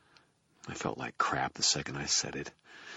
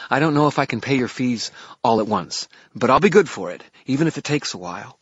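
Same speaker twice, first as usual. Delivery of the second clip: higher, loud, earnest promise; earnest girl voice; 8 semitones higher, 9 dB louder than usual. A narrator's reading. Merrill promising payment.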